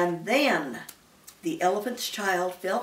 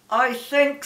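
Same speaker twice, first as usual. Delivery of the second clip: louder, gripping words between clenched teeth; through his teeth; 5 semitones higher, 7 dB louder than usual. A narrator's reading. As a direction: speak with a mouthful of nose. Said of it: "between his teeth".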